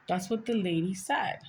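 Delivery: normal talking voice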